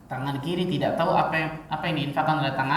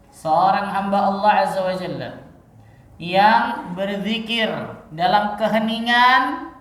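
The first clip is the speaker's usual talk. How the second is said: ordinary talk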